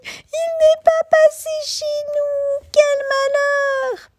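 very high, slow wailing mice; tiny mice lamenting; squeaky mouse voices. A narrator's reading. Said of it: "les souris qui se mettent à crier".

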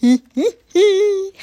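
loud high wolf cackle. A narrator's reading. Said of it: "rit vieux loup".

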